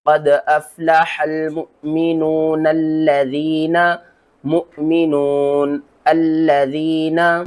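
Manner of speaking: slow melodic tajweed chant; Quran recitation. Shown as a demonstration. Teacher recites the verse to show pronunciation, slow chant. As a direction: recite it slowly, drawing out each vowel. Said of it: "दूसरे तरीके में अलिफ को पढ़ेंगे।".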